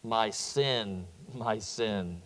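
echo. Retold or imitated. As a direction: let it come back like a faint echo. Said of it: "he hears back in the echo".

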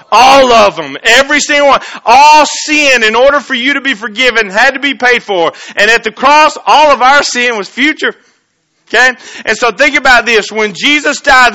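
loud, high preaching at full force; fiery preaching. Acted. Pastor rousing the congregation about sin, full-force preaching. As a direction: preach it out to the whole church.

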